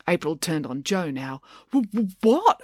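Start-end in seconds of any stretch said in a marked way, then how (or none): 1.7-2.6 higher, stuttering question; startled stammer. A narrator's reading. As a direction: trip over the first sound, caught off guard. Joe is put on the spot.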